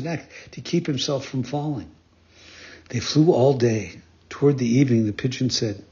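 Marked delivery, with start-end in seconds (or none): none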